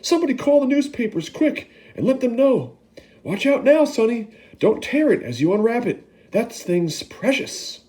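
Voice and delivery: yelling. Acted. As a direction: shout orders to the crowd. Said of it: "he yelled".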